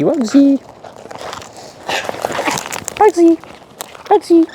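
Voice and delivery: calling the bird Bugsy in a squeaky sing-song; high calling. Real, not acted. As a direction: call him sweetly, way up high.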